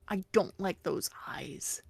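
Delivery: breathy hushed whisper. Real, not acted. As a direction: whisper it with distaste. Whispered distaste for the eyes.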